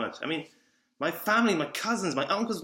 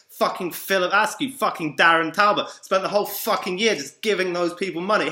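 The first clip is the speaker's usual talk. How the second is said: louder, heated outburst; angry rant; 3 semitones higher, 6 dB louder than usual. Real, not acted. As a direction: spit out the names in anger. Angry rant about people he paid.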